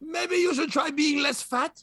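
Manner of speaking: high, slow mock-French advice; French accent. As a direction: draw it out in a fake French accent. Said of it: "like, in a French accent".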